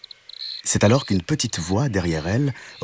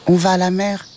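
little kitten voice; 11 semitones higher than usual. A narrator's reading. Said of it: "répondit le chat".

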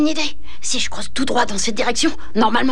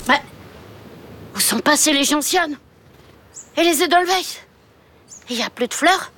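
ordinary delivery

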